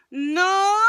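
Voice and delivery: high, loud whiny no as a vocal exercise; whiny frustrated cry. Shown as a demonstration. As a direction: whine like a frustrated kid. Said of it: "it sounds really whiny like this".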